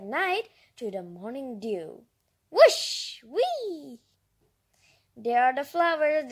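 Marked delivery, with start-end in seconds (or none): 2.5-3.2 whoosh sound. Acted. Fairies flying sound.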